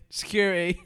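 mock call for security. Mock boss calls security.

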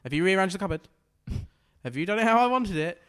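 bossy boss. Pretend. Pretending the wife is his new boss, loud, high and bossy.

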